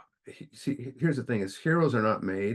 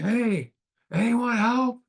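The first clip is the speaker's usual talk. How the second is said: high, loud cry up out of a pit; mock desperate call for help; 7 dB louder, much slower than usual. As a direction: shout up for someone to hear you. Man calling from the hole.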